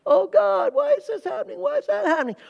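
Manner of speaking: high whiny wail; mock whining. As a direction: whine at God like a child. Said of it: "whining and complaining to God".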